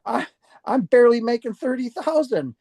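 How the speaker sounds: high-pitched, baffled disbelief; incredulous. Retold or imitated. Storyteller reliving the stranger's huge money offer, incredulous.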